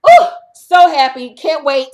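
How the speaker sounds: loud high ooh of delight; gleeful. Real, not acted. Vlogger gloating that Sheree is gone, gleeful ooh. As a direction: savour the joy.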